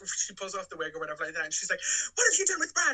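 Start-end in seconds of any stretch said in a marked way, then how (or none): 2.2-2.9 high, loud accusing outburst; mock alarm. Fan voicing Janet confronting the impostor, mock alarm. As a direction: demand it, theatrically horrified.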